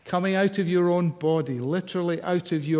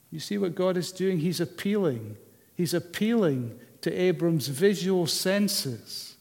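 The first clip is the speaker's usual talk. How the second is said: ordinary talk, nothing marked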